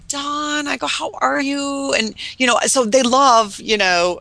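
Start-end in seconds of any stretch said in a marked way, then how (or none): 0.1-1.9 sweet greeting. Retold or imitated. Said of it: "I was like".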